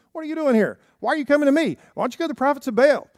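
worked-up prophet. Acted. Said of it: "he did not give a very polite, calm response".

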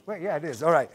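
ordinary talk

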